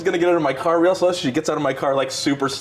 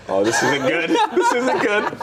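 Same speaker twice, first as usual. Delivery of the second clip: laughing dismay; 5 semitones higher, slower than usual. Retold or imitated. Driver realizing his passenger is trouble, laughing dismay. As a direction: worry breaking into laughter.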